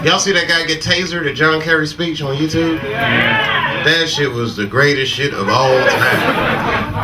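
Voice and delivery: ordinary delivery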